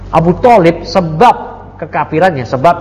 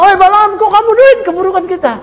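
much higher, indignant cry of protest; shocked villagers. Retold or imitated. His people protest the curse, higher mock outrage. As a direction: call out to Balaam in hammed-up alarm. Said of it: "Kaumnya bilang".

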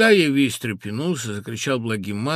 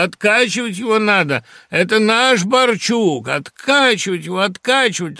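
crowd shouting; 8 semitones higher, 9 dB louder than usual. A narrator's reading. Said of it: "раздаётся в толпе".

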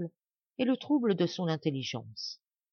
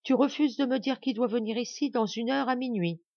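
insisting; 7 semitones higher than usual. A narrator's reading. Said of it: "insista madame Blanche".

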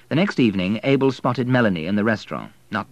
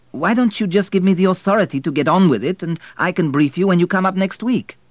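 lightly voiced eager young Abel, higher; a hint of Abel urging his boss, raised voice; Abel pressing on the phone; 9 semitones higher, 5 dB louder than usual. A narrator's reading. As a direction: push for authority, keen and insistent.

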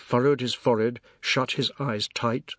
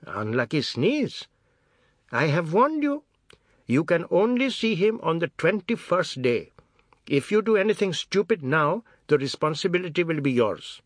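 stern warning; 6 semitones higher than usual. A narrator's reading. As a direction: repeat it, then warn him. Grandmother warns father.

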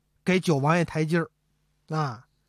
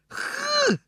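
a sudden, much higher startled gasp; shocked gasp. Acted. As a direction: gasp as if your hair stood on end. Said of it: "小辫儿嘣儿就支棱起来了". (刘墉吓得倒吸一口气)

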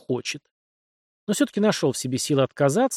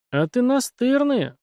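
slow, higher, a touch of annoyance; mild annoyance; 11 semitones higher, slower than usual. A narrator's reading. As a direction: think it with light irritation. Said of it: "с лёгкой досадой подумал". (с лёгкой досадой)

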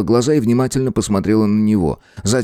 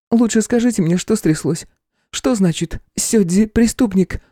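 put-on higher young woman's voice; 9 semitones higher than usual. A narrator's reading. Nuy voiced.

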